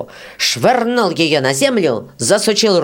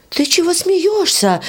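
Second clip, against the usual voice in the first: put-on high, slow, cross young girl's question; angry girl; 13 semitones higher, slower than usual. Varya crossly asks the beetle why it laughs. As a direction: snap at the giggling beetle. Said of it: "рассердилась Варя".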